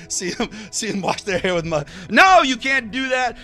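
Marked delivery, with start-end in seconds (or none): 2.1-3.4 much higher and louder mock dad refusal; firm no. Dad snapping a loud no at his child. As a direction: shut the kid down loudly.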